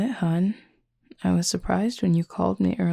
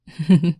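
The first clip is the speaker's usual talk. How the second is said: short, loud laugh; a quick, loud, amused laugh; amused burst of laughter; 9 dB louder than usual. Real, not acted. Amused at partner's glare.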